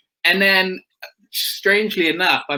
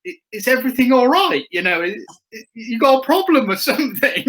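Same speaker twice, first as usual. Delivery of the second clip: lightly voiced nervous question, pitched higher; mock concerned question; 9 semitones higher than usual. Rider retelling how he quizzed the Yamaha man, mild mock nervous voice. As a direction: ask the bloke if he's ok in a mild mock nervous voice.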